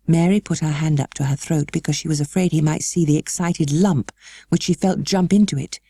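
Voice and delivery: plain reading voice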